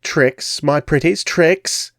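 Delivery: put-on rising high cackle of an old hag; cackling crone. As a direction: cackle with sly delight. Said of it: "she cackled".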